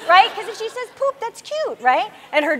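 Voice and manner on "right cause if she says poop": ordinary delivery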